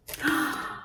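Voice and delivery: quick soft gasp of wonder; surprised gasp. A narrator's reading. Reader to children, soft gasp of wonder. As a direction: gasp before the reveal. Reveal of jacket.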